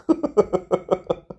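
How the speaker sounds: high chuckle in a run of short bursts; boastful, high-pitched laugh; mock villain laugh. Real, not acted. Teacher boasting no one can stop him, high gloating laugh.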